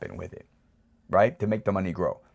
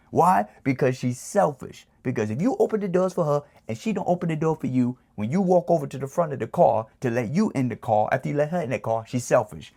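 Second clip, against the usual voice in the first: put-on raised-pitch Italian New York accent, insistent; 5 semitones higher than usual. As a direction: street gangster schooling his son in New York accent. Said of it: "in my New York accent".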